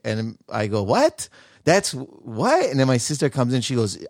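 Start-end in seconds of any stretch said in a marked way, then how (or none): 0.8-2.7 mock shock. His shocked reply retold.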